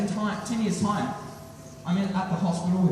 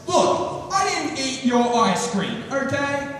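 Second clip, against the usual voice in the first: higher-pitched, drawn-out defensive insistence; mock angry defence; 7 semitones higher, slower than usual. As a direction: act cornered and push back hard. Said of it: "get up in his face and get angry".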